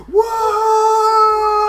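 very high shouted wah; orc battle cry. Acted. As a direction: bellow like a charging orc. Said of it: "we're going".

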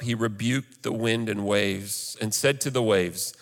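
ordinary talk

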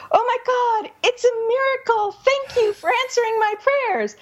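high, thrilled cry of thanks; a poor woman believing in a miracle; overjoyed. Retold or imitated. Poor woman thanking God for bread, high and overjoyed. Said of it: "she's like".

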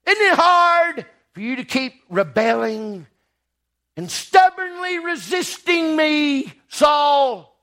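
God shouting at Saul; stern divine rebuke. Acted. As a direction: thunder it down at the stubborn man.

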